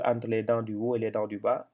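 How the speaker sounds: usual speaking voice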